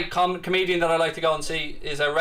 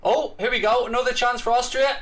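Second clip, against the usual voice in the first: high rising play-by-play; excited commentary; 7 semitones higher than usual. Real, not acted. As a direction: lift for an attack. Live commentary on a chance.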